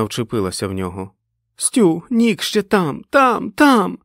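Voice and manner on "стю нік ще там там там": high, frantic yell, rising louder; screaming. A narrator's reading. Fran clutches Stu, Nick still inside, screaming. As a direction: scream it in panic, building. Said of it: "закричала вона до нього".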